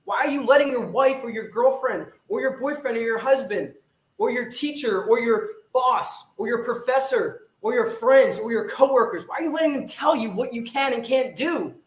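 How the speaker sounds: ordinary delivery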